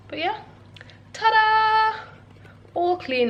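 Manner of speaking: excited. Real, not acted. Presents clean room.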